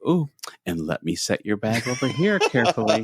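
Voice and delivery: hammed-up delicate fussing; mock carefulness. Imagined bag handler fussing over a cyclist's luggage, mock carefulness.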